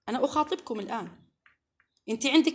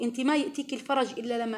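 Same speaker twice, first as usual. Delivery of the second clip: ordinary talk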